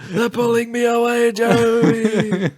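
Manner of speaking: high, loud, hammed-up cry for help; desperate screaming. Parent dragged away calls to child, mock desperate scream. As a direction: scream it out, drawing out the name.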